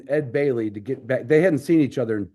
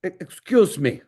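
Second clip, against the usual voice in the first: put-on German accent; 5 semitones higher than usual. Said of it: "with his German accent".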